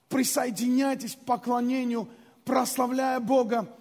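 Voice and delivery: usual speaking voice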